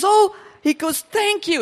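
gushing retold thank-you; demonstrative thanks. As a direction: imitate an effusive, grateful man. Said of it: "He's very demonstrative".

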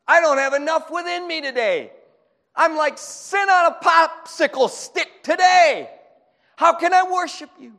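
high, loud cry to God; desperate prayer. Acted. Worshiper confessing emptiness to God, desperate cry.

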